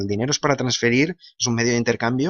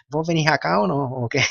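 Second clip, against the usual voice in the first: amateur Argentine accent, pitched up; 4 semitones higher than usual. Shown as a demonstration. Spaniard who knows few Argentines trying their speech, mock Argentine accent. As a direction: imitate an Argentine asking, in a higher lilt. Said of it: "no puedo hacerme mucho argentino".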